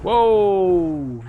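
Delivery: loud, drawn-out exclamation; real gamer surprise; startled whoa. Real, not acted. Startled during the match.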